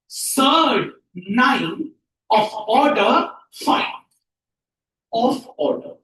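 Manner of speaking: firm, spaced-out model reading; word-by-word reading. Shown as a demonstration. Teacher corrects students' pronunciation, word by word. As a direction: give every word its own beat. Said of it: "इसको pronounce करेंगे".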